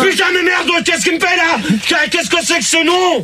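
high-pitched furious outburst; worked-up anger. Real, not acted. Host enraged by a caller's prank nickname, worked-up anger. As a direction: explode over the silly name. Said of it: "t'es énervé pour un rien".

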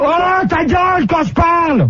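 high-pitched exasperated yell; angry outburst. Real, not acted. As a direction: shout down whoever cut you off. Host interrupted, tells caller to shut up. (coup de gueule aigu, exaspéré)

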